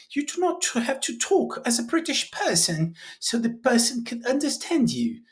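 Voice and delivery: high-pitched, badly faked British accent. Shown as a demonstration. Teacher jokes about mimicking natives, poor British accent. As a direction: put on a hammy British accent. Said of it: "My British sucks".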